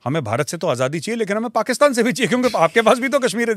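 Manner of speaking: high, fast indignant demand; retold JKLF demand. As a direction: rattle it off as the defiant group. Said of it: "one fine morning and said".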